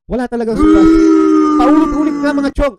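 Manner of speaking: loud, high outburst of agreement; emphatic shout. Real, not acted. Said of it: "Which is true". (pasigaw na mariing pagsang-ayon)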